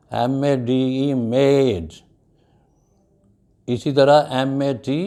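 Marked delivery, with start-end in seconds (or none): 1.1-1.9 "made" with a long A; teacher's held vowel in "made"; long A demo. Shown as a demonstration. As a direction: model "made", vowel as in "day". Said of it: "When we use A in the middle".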